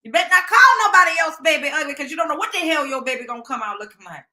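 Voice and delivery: loud, high Southern grandmother telling someone off; scolding Southern grandma. Retold or imitated. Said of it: "That's how she used to sound".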